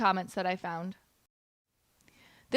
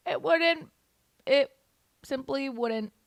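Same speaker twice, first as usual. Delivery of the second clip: ordinary talk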